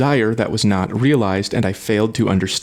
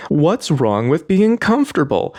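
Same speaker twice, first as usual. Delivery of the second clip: higher-pitched, mock-indignant question; defensive protest; 6 semitones higher than usual. Fan objecting to sweatshirt criticism, mock defensive. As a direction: imitate someone bristling at criticism.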